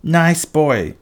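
high wheedling call; crying out. A narrator's reading. Anger calls to the boy holding her eye, high cry. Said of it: "Anger cried".

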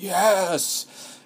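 high, eager burst of joy; an inner voice cheering; excited yes. Pretend. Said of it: "the subconscious is like".

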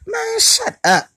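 high, slow exasperated shut-down. Real, not acted. Telling goofy women to shut up, high slow exasperation. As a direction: cut her off in disbelief.

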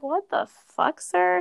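high, disbelieving what the fuck sir; incredulous. Retold or imitated. Retelling her shocked reply to a stalker, high and incredulous. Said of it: "And I was just like".

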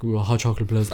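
lightly mumbled mock-dopey order; grunting straight guy. Awkward customer ordering hot chocolate, light grunting mumble. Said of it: "they just grunt all the time".

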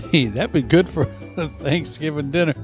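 ordinary delivery

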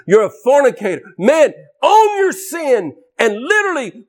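high-pitched fiery condemnation; full-force preaching. Real, not acted. Preacher confronting those too proud to confess, fiery condemnation. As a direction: hammer the sinner with judgment.

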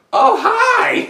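high, laughing mock greeting; imitating a funny donkey; Eddie Murphy donkey voice. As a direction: squeak out a delighted hello. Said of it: "If he sounded like Eddie Murphy".

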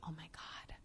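soft, whispered kindly put-off; quiet apologetic gatekeeper voice; hushed polite brush-off. Retold or imitated. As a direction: let them down gently in a near-whisper. Jeanette gently turning her away.

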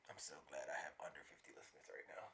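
hushed aside under the breath; whispering. Real, not acted. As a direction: whisper it so nobody hears. Aside after crude story.

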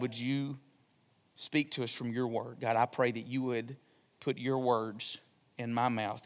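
soft, low, reverent pastoral prayer; hushed congregational prayer. Real, not acted.

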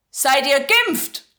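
loud, slow, curt innkeeper's question; gruff innkeeper. Acted. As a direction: bark it at the young man. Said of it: "Der Wirt unterbricht Jo barsch". (schroff und laut gefragt)

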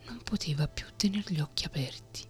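plain reading voice

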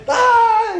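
loud, very high imitation wail; big high mock shriek; mock toddler scream. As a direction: wail like a toddler who got burnt. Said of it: "He's, like, crying".

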